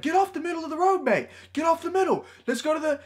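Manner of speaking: very high, rapid mock order; belligerent drunk. Drunk man orders dad out of the road, high mock demand.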